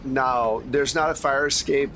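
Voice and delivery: ordinary talk, nothing marked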